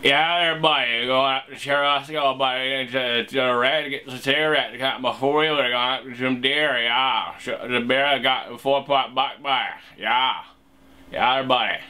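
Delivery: thick, run-together upstate New York accent. Shown as a demonstration. Local talking deer and four-wheelers, upstate New York accent. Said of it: "upstate New York accent impression".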